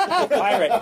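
high burst of put-on laughter; hammed-up giggle, laughing into the word; acted amusement. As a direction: crack up mid-lecture at the sight. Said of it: "'cause pirates make him laugh".

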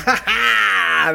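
high, mock cackle; loud imitation of women laughing; imitated group laughter. Passengers reacting to driver's joke, mock laughter. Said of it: "And they all went".

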